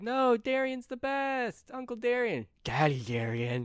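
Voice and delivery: put-on high, slow kids' praise; kids gushing. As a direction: gush like adoring campers. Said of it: "they're like".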